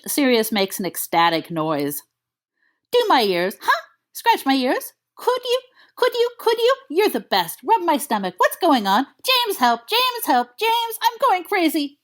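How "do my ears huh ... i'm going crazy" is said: high, pleading puppy yips; begging puppy. Acted. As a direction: beg like a dog, then panic. Dog Sirius begging then panicking.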